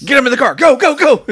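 high, frantic mock getaway shout; urgent yelling. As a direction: yell hurried orders as in a chase. Acted frantic getaway order.

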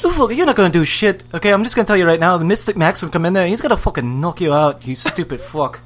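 rapid mock Irish trash talk; Irish accent. Threatening an opponent as a fighter, mock Irish accent. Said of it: "I was doing Irish".

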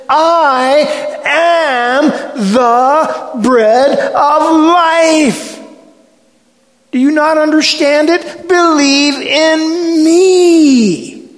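high, full-voiced shout as Jesus; impassioned cry, hugely stretched at the end; thundering declaration. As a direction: proclaim it loudly, drawing out the key words. Preacher voices Jesus's climactic declaration.

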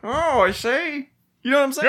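high-pitched vintage talker; old-timey wise guy. Acted. Co-host answers the host's bit, old-timey voice. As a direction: play along in an old movie voice.

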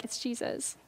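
hushed, breathless whisper; awed whisper. Acted. Pastor marveling at Jesus' red words, whispered. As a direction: breathe it out in awe.

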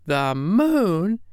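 high-pitched, incredulous exclamation; exclaiming. Acted. Miss Wren hears Willie is off to the moon, exclaiming. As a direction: cry it out high, as a question. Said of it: "cried Miss Wren".

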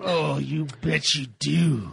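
slow, low seductive purr; sultry murmur. Pretend. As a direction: tease it out slowly. Mock seductive replies.

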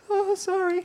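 high, meek "sorry"; mock the scolded kids apologizing; squeaky contrite kids; sheepish apology. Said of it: "everyone shudders and says".

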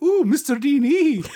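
high, slow, lilting Irish impression. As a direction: sing it up high with an Irish lilt. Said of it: "an Irish lilt".

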